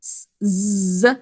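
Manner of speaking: "s, z" as a hiss, then a long buzz; "s, z" as isolated sounds; separate sounds. Shown as a demonstration. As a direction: hold the buzz in "s, z". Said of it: "making its second sound".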